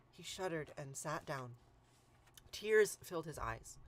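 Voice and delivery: ordinary delivery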